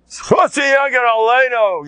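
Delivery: high, loud proclamation; shouting a slogan at full voice; impassioned shout. Retold or imitated. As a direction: cry it out defiantly. The Saba crying out.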